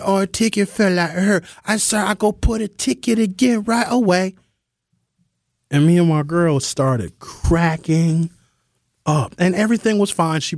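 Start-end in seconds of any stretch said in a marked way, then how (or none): 0.0-4.3 put-on higher, accented broken English; imitated server accent. Comic mimics waitress explaining ticket fell out, accented. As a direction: insist like the apologetic waitress. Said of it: "She said".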